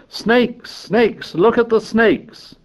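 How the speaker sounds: restrained high-pitched scream of alarm; mock scream. A narrator's reading. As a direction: cry out high like little boys. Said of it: "The little boys screamed at them".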